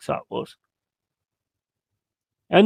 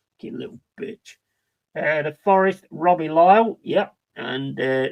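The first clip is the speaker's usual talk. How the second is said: usual speaking voice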